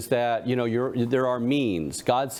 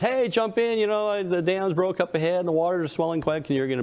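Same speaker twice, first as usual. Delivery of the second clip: urgent rescuer call; 8 semitones higher than usual. Retold or imitated. Boat rescuer in the joke.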